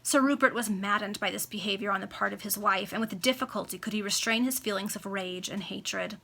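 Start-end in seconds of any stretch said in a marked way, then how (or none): none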